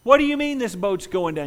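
high, loud incredulous taunt; mocking partygoer. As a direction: scoff at the one leaving. Crowd mocking the leaver.

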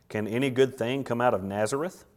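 dryly doubt it; unexcited. Acted. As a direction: Nathanael unimpressed by Philip's news. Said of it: "Nathanael's not nearly as excited".

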